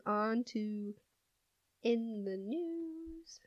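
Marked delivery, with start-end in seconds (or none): 1.8-3.4 segment announcer. Acted.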